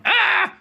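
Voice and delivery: loud, high-pitched shriek; startled scream. A narrator's reading. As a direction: scream in sudden alarm. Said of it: "screamed Jemima".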